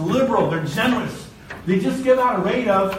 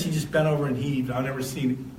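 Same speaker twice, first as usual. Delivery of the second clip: ordinary talk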